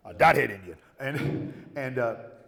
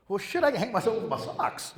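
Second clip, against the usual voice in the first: mock indignant retort; 8 semitones higher than usual. Retorting to nurse.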